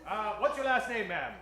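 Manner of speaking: usual speaking voice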